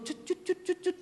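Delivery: soft, high imitated bird tweets; quick whistly mock birdsong; bird chirps. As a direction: chirp lightly like a little messenger bird. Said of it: "lewat burung gitu".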